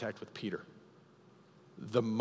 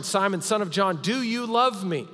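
pleading question; 8 semitones higher, 5 dB louder than usual. Acted. Jesus repeats the question.

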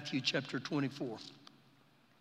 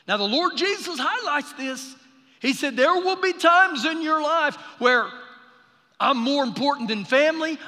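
preaching at full force; 8 semitones higher, 7 dB louder than usual. Acted. Preacher challenging the congregation about obeying God, fervent preaching. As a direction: press the question on the church.

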